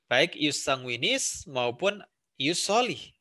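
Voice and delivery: ordinary talk, nothing marked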